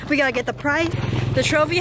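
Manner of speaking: normal talking voice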